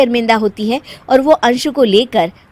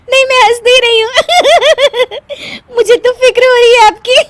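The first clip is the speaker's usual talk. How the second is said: high, fast, loud giggling; fast giggly high denial; laughing through denial; 7 dB louder, faster than usual. Acted. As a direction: protest worry while laughing. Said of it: "ऊपर से तुम हंस रही हो".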